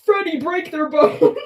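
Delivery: put-on four-year-old coded. Quoting the pouting villain like a sulky four-year-old. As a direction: sulk like a toddler who was told no. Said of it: "he's so four-year-old coded".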